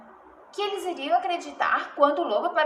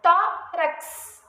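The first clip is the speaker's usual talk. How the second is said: "Tórax" split as TÓ, rax; syllable division; 3 semitones higher, 3 dB louder than usual. Shown as a demonstration. Teacher shows the X stays attached, "Tórax" split TÓ-rax. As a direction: break "Tórax" in two, keeping the x on rax. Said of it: "O X fica junto".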